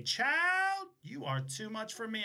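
put-on high, sassy "Child" then drawn out; sassy flight attendant. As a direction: play the sassy older coworker. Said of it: "she was a sassy, sassy flight attendant".

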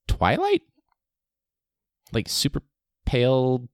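you can't be serious, Twilight?; squeaky-high disbelieving question; incredulous. Real, not acted. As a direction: pitch leaps way up in disbelief. Incredulous at the new Batman casting.

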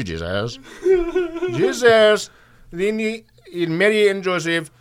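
put-on slow, high Indian-accented priest. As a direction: preach it slowly in an Indian accent. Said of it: "Father Philip put it something like this".

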